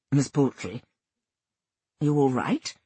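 lightly voiced vicar, checking she is all right; low, gentle inquiry in a vicar's voice. A narrator's reading. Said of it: "It was the vicar".

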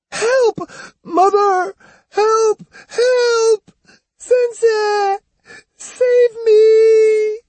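high panicked screaming for help; terrified screams. A narrator's reading. Boy held upside down over the railing, terrified screaming. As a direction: scream as if dangled over water. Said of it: "The screams".

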